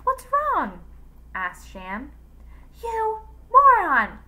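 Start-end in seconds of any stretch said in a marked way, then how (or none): 0.0-0.8 high, loud startled question; alarmed question. A narrator's reading. Husband reacts to his wife's scream, alarmed. 2.8-4.2 slow, high, very loud insult; angry shout. A narrator's reading. Wife rages at her clueless husband, angry shout. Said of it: "shouted Jane".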